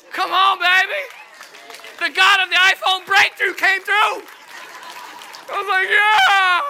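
very loud, high celebration; preacher celebrating a free iPhone, shouting; exultant shout. Real, not acted. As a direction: whoop it up for the congregation.

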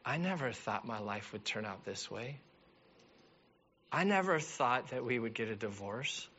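soft, hushed, heartfelt; tender appeal. Real, not acted. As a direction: speak gently to the hurting.

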